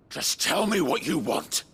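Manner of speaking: angry demand. Acted. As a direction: snap the demand at him. Hero demands answers.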